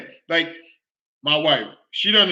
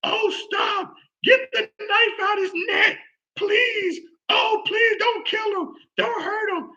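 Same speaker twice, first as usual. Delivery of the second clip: high, crying shriek, begging; terrified screaming; slower than usual. Retold or imitated. Woman begging the killer to stop, screaming in tears. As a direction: shriek and plead in panic as the victim. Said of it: "She screams".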